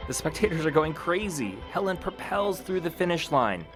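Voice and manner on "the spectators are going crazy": ordinary delivery, nothing marked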